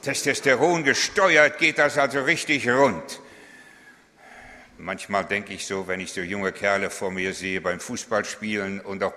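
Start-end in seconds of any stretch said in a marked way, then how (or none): 0.0-3.2 loud, higher, fired-up; hammed-up macho speed talk; revved-up boast. Testosteron-Fahrstil, aufgedreht vorgeführt. (lauter, aufgedrehter Macho-Ton)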